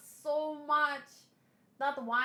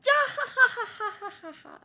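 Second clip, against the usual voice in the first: Luffy's laugh; 9 semitones higher, much faster than usual. Retold or imitated. Reader voicing Luffy's triumphant laugh, fading. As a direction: ham up a hero's cackle. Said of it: "And then Luffy just laughing".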